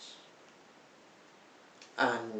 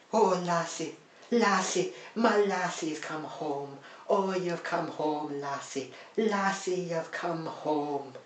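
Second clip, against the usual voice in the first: higher, hammed-up Scottish cry of joy to a dog; mock Scottish reunion; 6 semitones higher than usual. Vlogger mocking the film's reunion scene, in a hammed-up Scottish accent. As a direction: overplay a tearful Scottish boy greeting his dog. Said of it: "everyone's doing a Scottish accent".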